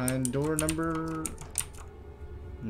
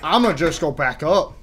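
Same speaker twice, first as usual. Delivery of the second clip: louder, rising, insistent repeat; 8 dB louder than usual. Real, not acted. Gamer insisting on retreating upstairs, louder insistent repeat. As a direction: push it out louder each time.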